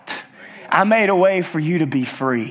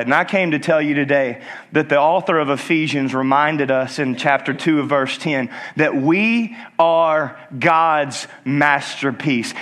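normal talking voice

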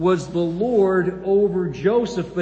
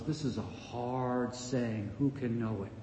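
muttered complaint; 7 semitones lower, 11 dB quieter than usual. Acted. The crowd's grumbling reply voiced.

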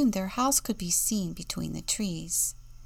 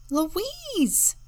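much higher, relieved shout; relieved cry; 12 semitones higher than usual. A narrator's reading. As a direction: cry out the sister's name with relief. Sheila sees her sister.

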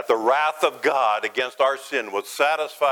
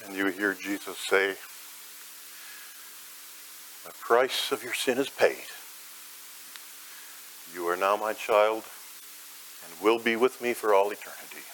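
tender sermon visualization; 3 semitones lower than usual. Acted. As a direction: lead the listener gently into peace. Gentle guided imagery of grace.

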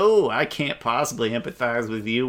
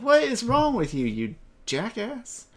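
real exasperated outburst; 11 semitones higher than usual. Real, not acted. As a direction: vent real annoyance at her. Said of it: "pisses me off".

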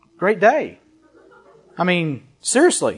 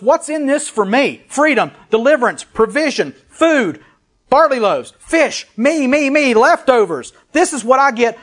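high-pitched list of selfish demands; mock greedy clamour; 5 semitones higher than usual. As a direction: imitate a grasping crowd, higher and insistent. Voices a greedy, frenzied crowd.

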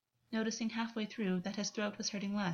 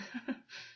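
soft, breathy little laugh; short laugh; 7 dB quieter than usual. A narrator's reading. August amused by Gwen's hands on him, short quiet laugh. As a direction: let out a quiet puff of laughter. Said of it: "August laughed".

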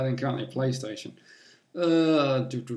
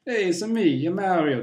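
Mario voice; 6 semitones higher, 6 dB louder than usual. Retold or imitated. Quoting Mario catchphrase.

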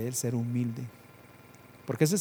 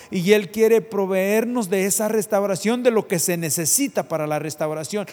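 fervent preaching; 5 semitones higher, 7 dB louder than usual. Acted. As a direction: preach it out to the congregation. Preaching at full force.

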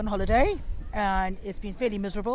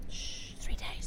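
whisper; 7 dB quieter, slower than usual. Real, not acted. Asked how long left.

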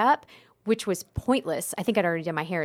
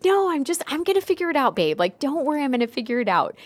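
reassuring; 7 semitones higher, 3 dB louder, faster than usual. Retold or imitated. Reassuring husband, retold high.

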